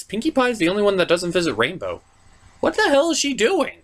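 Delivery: usual speaking voice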